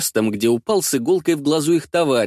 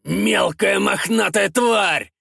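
a slow, drawn-out roar, much higher; 7 semitones higher, much slower than usual. Acted. One thug roaring at the furry creature, slow shout. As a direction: bellow the insult slowly at the little creature. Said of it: "ревёт один из них".

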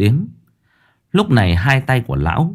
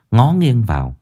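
ordinary delivery, nothing marked

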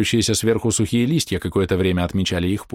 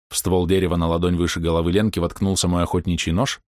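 usual speaking voice

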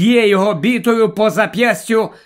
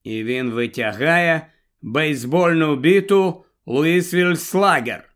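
ordinary delivery

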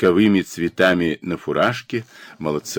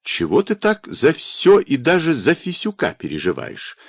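higher-pitched, mildly outraged question; indignant; 8 semitones higher than usual. A narrator's reading. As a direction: protest with a touch of indignation. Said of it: "возмутился Кульницкий". (возмущённо, на повышенном тоне)

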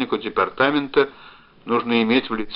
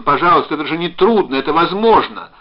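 high, loud begging; desperate raised entreaty; shouted plea; 6 semitones higher, 8 dB louder than usual. A narrator's reading. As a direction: beg urgently, pitch climbing. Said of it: "закричал он".

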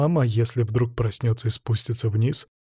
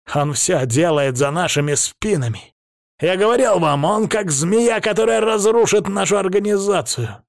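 high-pitched, heated accusation; indignant rant; 8 semitones higher than usual. A narrator's reading. Krestovsky accuses the rival before the council, indignant rant. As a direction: warn them with rising outrage.

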